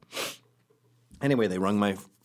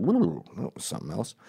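knowing aside. Retold or imitated. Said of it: "sort of going".

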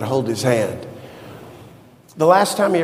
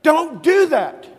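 mother's stern order; 9 semitones higher, much slower than usual. Retold or imitated. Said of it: "She said".

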